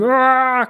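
high, drawn-out "aah" yell; acted scream. Smith's scream as he strikes Neo, high yell. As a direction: scream it high, mid-narration. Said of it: "scream rises up from him".

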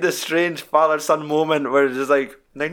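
ordinary talk

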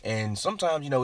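normal talking voice

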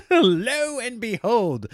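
slow, drawn-out, high mock-antique delivery; old-timey exclamation. Comedian mocking his own catchphrase in old-timey voice. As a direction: ham it up like a nineteenth-century gent. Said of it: "like I'm in the eighteen hundreds or something".

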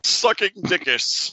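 high, slow mock monster name; mock grand name. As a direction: stretch it out like a grand dinosaur title.

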